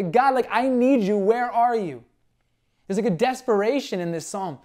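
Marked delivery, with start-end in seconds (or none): 0.0-2.0 higher, louder cry for God; half-acted desperate cry; desperate plea. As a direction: call out in desperation. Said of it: "Do you see it just like the".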